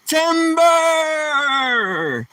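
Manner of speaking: high, drawn-out call of Tim Burr like a woodcutter; lumberjack call. Acted. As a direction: yell Tim Burr as the tree falls. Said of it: "guy chops a tree down".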